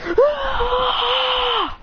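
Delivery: long, high sustained scream; high anime power-up yell; acted power scream. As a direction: scream to crack a planet. Hero screams, planet cracks.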